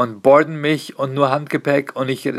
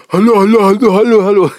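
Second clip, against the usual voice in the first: put-on loud, high, repeated kid calls; kids shouting into mic; 7 semitones higher, 9 dB louder than usual. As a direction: yell hello into the microphone like a child. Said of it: "Kinder ... immer so". (Kinder, die laut und hoch ins Mikrofon rufen)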